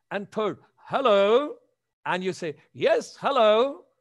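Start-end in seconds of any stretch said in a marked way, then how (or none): 0.8-1.6 energetic hello. Shown as a demonstration. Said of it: "your energy". 2.7-3.9 bright eager hello. Shown as a demonstration. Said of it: "your energy".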